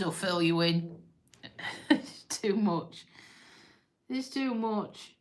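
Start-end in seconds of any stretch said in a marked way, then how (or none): 1.9-5.1 soft, slow and choked up; too upset to speak up, drawing it out slowly; real tearful overwhelm. Real, not acted. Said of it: "Let me stop crying first".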